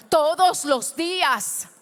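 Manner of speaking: "todos los días" stretched slowly, every word weighed; slow, drawn-out stress. Shown as a demonstration. Preacher making the church repeat it, slow and emphatic. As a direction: lay each word down slowly. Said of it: "vuelve y repítalo".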